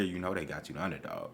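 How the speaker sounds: low, hushed, quick aside; mock whisper. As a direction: drop right down as if whispering in his ear. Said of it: "somebody's gonna whisper in his ear".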